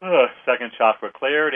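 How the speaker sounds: words stretched by a yawn. Real, not acted. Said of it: "Wow, I'm yawning".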